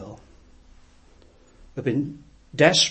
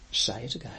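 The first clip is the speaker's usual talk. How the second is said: whisper; 5 semitones lower, 12 dB quieter, faster than usual. Retold or imitated.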